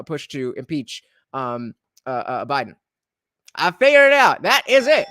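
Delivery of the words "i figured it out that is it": loud triumphant declaration. Real, not acted. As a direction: announce the discovery loudly. Triumphant realisation.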